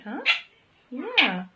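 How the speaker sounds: ordinary talk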